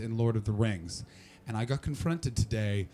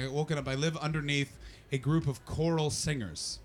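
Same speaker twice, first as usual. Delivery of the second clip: ordinary talk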